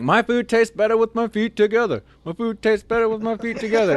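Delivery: high, loud chanting yell; mock yell. Recruit yelling the slogan around the dining hall, high loud chant. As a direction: belt the slogan out proudly.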